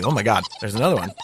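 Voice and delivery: ordinary talk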